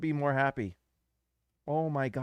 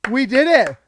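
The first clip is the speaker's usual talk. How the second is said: higher, louder cry of victory; triumphant shout; 9 semitones higher, 13 dB louder than usual. Real, not acted. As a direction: shout it like your team won. Celebrating the pick.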